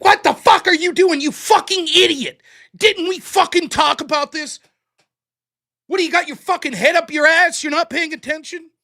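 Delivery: loud, high, cursing tirade from a hard-driving coach; furious coach yelling. Retold or imitated. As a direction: tear into him like a raging coach. Intense debate coach berating students.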